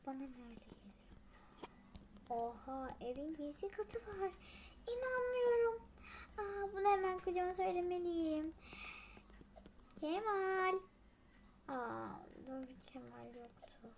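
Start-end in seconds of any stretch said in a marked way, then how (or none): 0.1-0.9 soft unvoiced whisper; astonished whisper. Finding a secret floor, astonished whisper. 4.9-5.8 much higher, louder disbelief; amazed. Acted. Secret floor found, amazed exclamation. 11.7-13.9 soft unvoiced whisper; disappointed whisper. Remembering Kemal is gone, deflated whisper.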